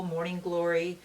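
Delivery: ordinary talk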